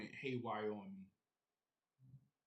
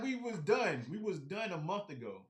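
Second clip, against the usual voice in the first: loud, high mock outburst that settles down; retold outburst; 6 semitones higher, 4 dB louder than usual. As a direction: blow up at him, then cool off. Retold blow-up at trader.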